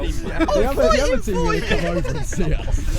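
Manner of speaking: high and loud, drawn out; excited shout. Real, not acted. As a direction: call it out, all worked up.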